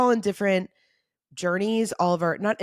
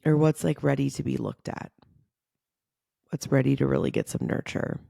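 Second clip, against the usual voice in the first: guided meditation. Acted. Leads a guided breathing meditation.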